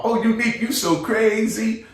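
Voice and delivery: mock caller voice. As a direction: play the friend on the phone teasing Unique. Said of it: "they hear them say".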